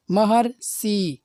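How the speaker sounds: महर्षि drawn out slowly so the अर is heard; slow classroom pronunciation of महर्षि with a clear अर; slow demo. Shown as a demonstration. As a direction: stretch महर्षि for the students. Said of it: "अर की ध्वनि निकल रही है".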